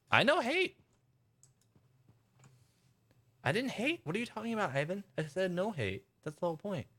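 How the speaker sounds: high-pitched, squeaky indignation; mock protest. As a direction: protest in a high voice, playfully defensive. Protesting a viewer's accusation of hate.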